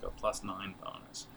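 ordinary talk, nothing marked

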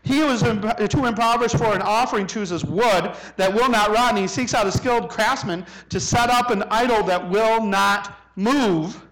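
high, loud scripture proclamation; full-force preaching. Acted. Preacher reading Isaiah to the congregation, full-force proclamation. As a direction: thunder the verse at the congregation.

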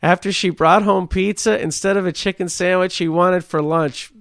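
mock anchor reading the headline; news read. Reads news story aloud.

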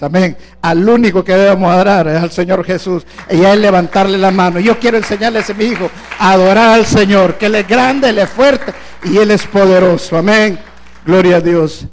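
a pastor thundering at the faithful about football idols; full-force preaching. Acted. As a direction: hammer it home to the congregation.

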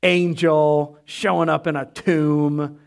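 slow, drawn-out scoffing; acted slow disbelief; dismissive skeptic. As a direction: drag it out like an unimpressed doubter. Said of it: "still being like".

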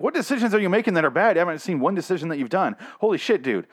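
high, fast and incredulous; exasperated fast rant. Retold or imitated. Reader mocking Dwight's panic, high fast disbelief. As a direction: fire questions at Dwight in disbelief. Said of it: "That's why I'm speaking fast right now".

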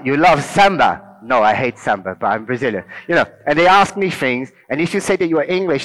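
normal talking voice

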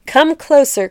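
cried out. Acted. Queen calling the frightened townsfolk to come see, crying out. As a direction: call the people forward. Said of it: "cried Anayat".

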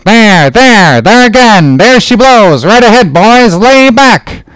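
high whaler's holler; excited calling out. A narrator's reading. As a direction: sing out the sighting to your crew. Boatman calls whale sighting to crew.

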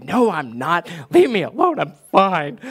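hammed-up grouchy denial, loud and high; mock outburst, jumping up in pitch; defensive snapping. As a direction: play a touchy dad insisting he's okay. Retold dad snapping back at family.

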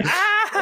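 a sudden high mock yelp of shock; startled yelp. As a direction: yelp like you just saw him drop through the ceiling. Seeing friend fall on live feed.

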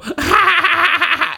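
high, loud goofy giggle impression; Adam Sandler's squeaky laugh. Shown as a demonstration. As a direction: break into the silly Sandler cackle. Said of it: "your laugh where you go".

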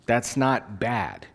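normal talking voice

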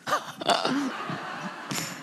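soft, voiceless wheezing laugh; real laugh. Real, not acted. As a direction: laugh helplessly on the breath. Laughs at her own story.

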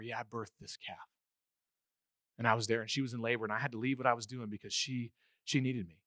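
low, soft farmer remembering; hushed, low-pitched reminiscence; quiet recollection. Acted. Voices farmer recalling the birth.

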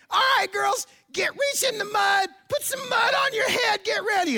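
hammed-up motivational yell, very high and strained; rally the girls at the top of your voice; high, over-the-top coach bellowing; megaphone pep-talk shout. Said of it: "I have this megaphone".

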